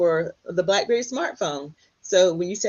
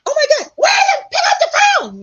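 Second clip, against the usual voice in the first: mom shouting high and loud, almost crying with joy; excited shout; 9 dB louder than usual. Retold or imitated. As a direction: yell for dad across the house, overjoyed. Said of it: "yelling at my dad".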